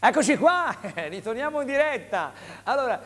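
loud, high on-air return; infomercial. Real, not acted. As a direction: welcome viewers back with a burst. Host back from commercial.

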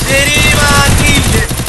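loud, high, drawn-out curse; angry cursing shout. Real, not acted. Gamer cursing under enemy fire, real angry shout.

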